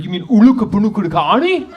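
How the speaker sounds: mock the tourist, rattling off the name; condescending local. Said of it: "They're like".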